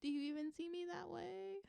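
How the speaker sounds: high-pitched, doubtful mock pleading; voicing the partner's doubt. Tarot reader voicing the partner's thoughts, high and questioning. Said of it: "could also be them being like".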